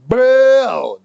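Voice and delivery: loud, high "Bro"; excited. Real, not acted. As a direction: burst out with it, overwhelmed by flavor. Raving about steak.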